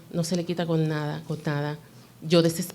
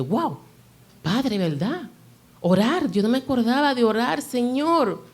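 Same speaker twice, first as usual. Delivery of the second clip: catching yourself, higher and brighter; sudden realization; 7 semitones higher than usual. Retold or imitated. Mother to God, remembering to pray, surprised and higher. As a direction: voice jumps higher in surprised relief.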